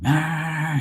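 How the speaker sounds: high mock tantrum noise; mad whine. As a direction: imitate a fed-up nephew's angry wail. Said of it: "he was all mad and shit".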